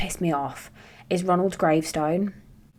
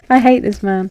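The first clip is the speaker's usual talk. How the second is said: louder, laughing through I hate this man; louder laughing repeat; 2 semitones higher, 8 dB louder than usual. Real, not acted. Woman venting about a killer called Ronald, laughing. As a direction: laugh as you say it again.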